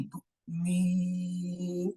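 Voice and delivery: میم held for two counts; long madd on meem. Shown as a demonstration. Teacher drawing out میم for students. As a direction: stretch the vowel of میم slowly. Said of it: "دو انگلیاں کھولنے کے برابر وقت لگا".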